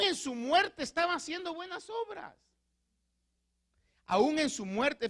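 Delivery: full-force pulpit fervour; fervent preaching. Acted. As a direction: build to a ringing climax.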